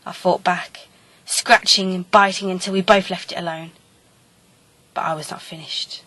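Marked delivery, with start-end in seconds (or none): none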